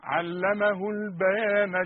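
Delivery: علمه البيان drawn out very slowly; slow recitation. Shown as a demonstration. Teacher recites slowly for students. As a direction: stretch البيان out. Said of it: "لكن لما أقول".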